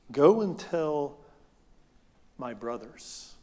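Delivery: slow and gentle, fading very soft; a touch of tender Jesus, unhurried. Acted. Jesus sending word to his hiding disciples: slow, tender. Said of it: "speaks tenderly when he's speaking".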